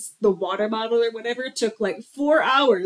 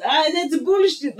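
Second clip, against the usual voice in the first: Tommy Wiseau impression; 8 semitones higher, 4 dB louder than usual. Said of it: "where he was".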